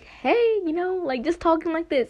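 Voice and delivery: high, easy "hey" settling into chat; casual friendly chat. Shown as a demonstration. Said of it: "This is what I want".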